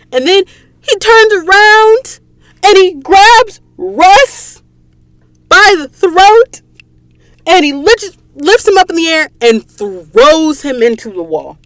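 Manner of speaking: excited retelling. Real, not acted. Fan retelling the climax to viewers, squealing with excitement. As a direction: voice shoots high and loud with a cry of excitement.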